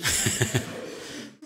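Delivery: quiet breathy chuckle; soft laugh. Real, not acted. Teacher chuckling softly while thanking student. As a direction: let a small laugh out.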